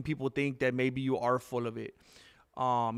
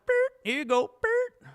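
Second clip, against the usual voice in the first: very high and louder, a robotic beep; mock beep voice; 6 dB louder than usual. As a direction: chirp it like a machine. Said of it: "And you just went".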